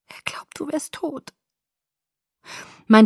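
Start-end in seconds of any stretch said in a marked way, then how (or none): 0.1-1.4 very soft, stifled whisper; choked whisper. A narrator's reading. Alice reveals what Edward believes, in a choked whisper. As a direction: barely get the words out. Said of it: "Ihre Worte waren nur ein ersticktes Flüstern".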